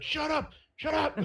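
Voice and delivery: very high-pitched retort; high mock yell. Everyone silencing the yelling hostage, high mock yell. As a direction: squeal it as a mock order.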